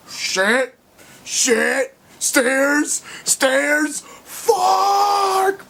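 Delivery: high, loud panicked yelps getting shriller; a robot freaking out at the stairs, yelling; frantic mock panic, pitch climbing to a shriek; panicked screaming.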